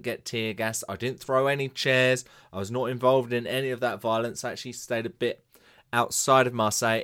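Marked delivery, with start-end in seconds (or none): none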